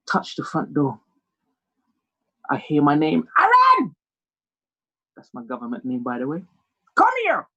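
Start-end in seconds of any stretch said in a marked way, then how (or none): 3.4-4.0 high, piercing mum's yell; acted shout for her son; calling out. Mother calls his name from inside. 7.0-7.5 high mother's call; sharp acted summons, pitched up; summoning yell. Mother summons her son.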